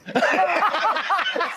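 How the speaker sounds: high helpless laugh; burst of laughter. Real, not acted. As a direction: crack up laughing. Big laugh at the news.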